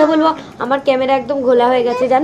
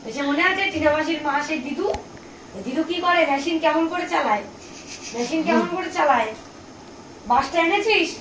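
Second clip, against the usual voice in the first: put-on higher, softer baby-talk voice; 5 semitones higher, 12 dB quieter, faster than usual. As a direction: coax the toddler gently along. Mother coos to baby.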